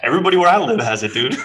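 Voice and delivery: fast, high and loud through laughter; laughing. Acted. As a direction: blurt it out cracking up. Laughing joke about accents.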